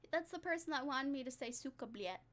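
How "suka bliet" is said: Russian impression. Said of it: "that's my Russian impression".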